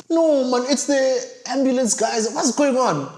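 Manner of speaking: viewer's mock shock at the ambulance guys; mock astonished. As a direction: act shocked, hammed up. Retold reaction to the ambulance guys.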